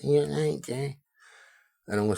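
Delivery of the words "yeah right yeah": high squeaky mimic of his mate; mock him in a high little squeak; imitating a whiny squeaky voice. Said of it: "squeaky little voice".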